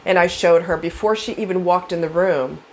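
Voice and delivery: usual speaking voice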